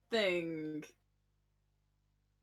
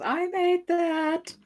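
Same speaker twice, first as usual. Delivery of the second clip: proud audience member; 9 semitones higher, 3 dB louder, much slower than usual. Retold or imitated. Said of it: "a human goes".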